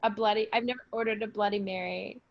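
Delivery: normal talking voice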